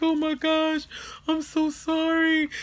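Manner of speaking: high, loud mock-crying apology; tearful apology. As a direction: act out wailing an apology, drawn out. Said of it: "I was crying".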